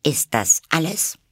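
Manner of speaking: put-on high girl's voice, anger held in; hidden annoyance. A narrator's reading. Alice hides her anger from the caterpillar. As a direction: swallow your anger politely. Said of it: "ihren Ärger so gut sie konnte verbergend".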